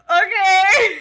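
high, loud mock-baffled reply; hammed-up, laughing acceptance; exaggerated baffled okay. Retells reacting to slang lesson.

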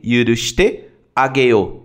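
slow, careful articulation; slow drill. Shown as a demonstration. Teacher breaking down the verb for students, slow and careful. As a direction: lay each syllable out for the learner. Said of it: "teacher breaks down phrase slowly".

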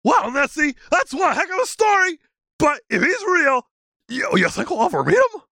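high dopey creature voice; goofy high-pitched monster; Sasquatch voice. Acted. Sasquatch character voice.

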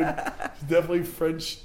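normal talking voice

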